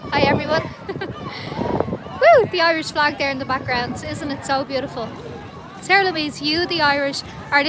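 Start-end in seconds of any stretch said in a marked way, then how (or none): 0.9-2.4 high cheering whoop; squealed woo; excited woo. Real, not acted. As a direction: let out a whoop of joy. Cheering on livestream.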